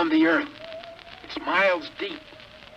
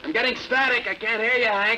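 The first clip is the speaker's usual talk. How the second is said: excited radio call; 7 semitones higher than usual. Acted. Pilot reliving chase on radio.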